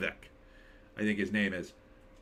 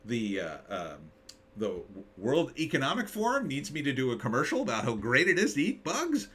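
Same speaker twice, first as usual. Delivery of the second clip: mocking Nicole Kidman. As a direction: play her as an eager shill chasing the money. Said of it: "It was like".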